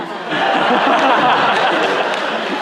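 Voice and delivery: a long, high, ringing laugh. Real, not acted. Speaker laughing after betting a thousand dollars. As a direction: let the laughter roll out.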